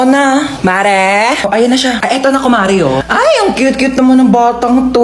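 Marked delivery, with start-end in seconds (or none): 3.0-5.0 put-on loud, gushing baby-talk coo; aunt fawning over a baby. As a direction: gush over the cute baby.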